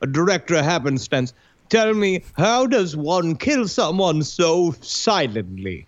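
hammed-up British interviewer, slowing to a crawl; Peter Ustinov. Host mimicking a British actor questioning an agent, ponderous. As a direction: ask with ponderous put-on British drama. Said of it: "he was just like".

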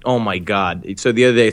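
normal talking voice

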